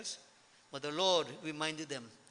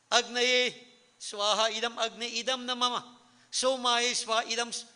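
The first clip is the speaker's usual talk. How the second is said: higher, firmly intoned offering mantra; raised-pitch Sanskrit chant; mantra recitation; 5 semitones higher, 4 dB louder than usual. Shown as a demonstration.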